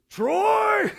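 loud, high summons up the stairs; mom's angry yell. Retold or imitated. Mother calling her son down to face police, angry yell. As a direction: yell his name like a furious mother. Mom calls son downstairs.

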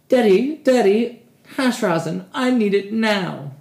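put-on high, loud whining child voice, dragging the end; whiny begging kid. Mocking hash fans begging for rosin like a whiny kid. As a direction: beg like a spoiled kid pleading with his father.

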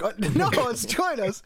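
high, loud, drawn-out laughing protest; amused correction. Retold or imitated. Retelling his answer to mom's mishearing, high laughing protest. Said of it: "I was like".

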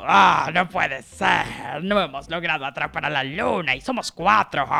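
a big lion fuming at failure; low lion voice, mock exasperated; furious lion. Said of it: "Esto puso al león muy furioso".

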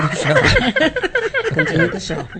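high giggly voice, cracking up; laughing. Real, not acted. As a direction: say it while breaking into laughter. Giggling while calling the show to order.

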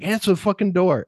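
mild mock angry snap; upset order. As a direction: bark at the henchman, annoyed. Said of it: "the guy's upset that he has to answer the door".

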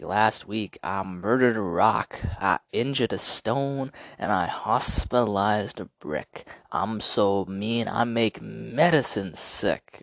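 hammed-up champion swagger; Ali poem. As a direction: drawl out the brags. Reciting Ali's famous poem.

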